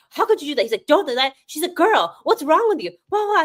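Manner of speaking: high-pitched playful telling-off; mock scolding. [name] scolding Malik for blocking reporter, high mock scolding.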